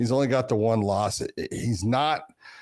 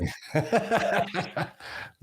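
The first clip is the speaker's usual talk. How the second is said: breathy laugh; 9 semitones higher, 4 dB quieter than usual. Real, not acted. Amused at yawn remark.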